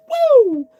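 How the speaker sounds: high loud woo; party whoop; excited whoop. Real, not acted. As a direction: let out a big joyful whoop. Excited whoop while singing.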